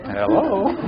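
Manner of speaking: sudden polite phone greeting; telephone voice. Shown as a demonstration. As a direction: switch to a sweet phone hello. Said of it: "Everybody have a telephone voice?".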